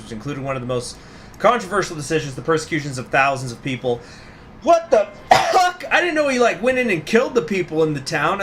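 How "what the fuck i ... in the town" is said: high, loud stunned cry; shocked outburst. Real, not acted. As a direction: blurt it out in disbelief. Shocked at game lore.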